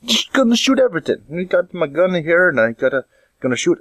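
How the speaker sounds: muddled, badly faked Canadian-Jamaican accent. Hunter with his gun in the woods, bad Canadian-Jamaican accent. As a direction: start high in a mixed Canadian-Jamaican lilt. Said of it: "kind of Canadian Jamaican".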